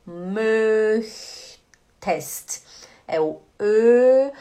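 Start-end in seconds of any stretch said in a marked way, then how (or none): 0.0-1.5 teacher sounding out "Möchtest" in parts; drawn-out pronunciation demo. Shown as a demonstration. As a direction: stretch "Möch", pause, then a crisp "test". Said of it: "Ela fala assim, ó".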